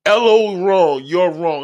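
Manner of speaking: hammed-up smug hater; mocking commenter voice. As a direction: parody the commenter's smugness. Mock-reading commenter's reply.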